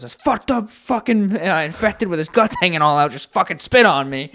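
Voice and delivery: loud, high growled hero line; gruff action hero. Retold or imitated. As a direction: bellow it like a video game hero. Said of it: "line where he goes".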